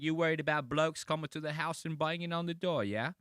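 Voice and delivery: high-pitched, hammed-up British voice; mock British accent. Mocking a British remake of a TV monologue, exaggerated British accent. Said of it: "he's trying to do it in, like, a British accent".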